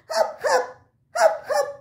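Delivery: high, bouncy hap hap; high playful hopping calls; hopping sound. Acted. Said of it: "kata badak".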